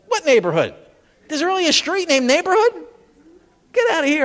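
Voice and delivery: high, stunned disbelief at a street name. Retold or imitated. Comic reacting to a street called Neighborhood, stunned disbelief. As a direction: gasp it out like you can't believe the map. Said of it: "I'm like".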